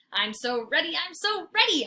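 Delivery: excited dog; eager bark. A narrator's reading. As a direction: bounce with impatience. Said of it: "I bark".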